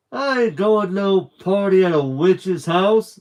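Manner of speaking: loud, slow, high refusal; a boy hollering that he won't go near the witch's house; defiant shout. A narrator's reading. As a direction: yell it like a scared stubborn boy. Stevie refusing, frightened of witch.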